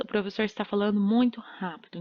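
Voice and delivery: usual speaking voice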